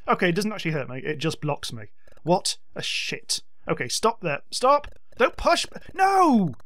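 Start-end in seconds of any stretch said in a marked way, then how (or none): 4.5-6.5 high, strained panicked protest; outraged panic. Real, not acted. Gamer protesting as an enemy shoves his robot, panicked. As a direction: protest shrilly as you're shoved.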